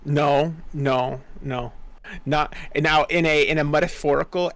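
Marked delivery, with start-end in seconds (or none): none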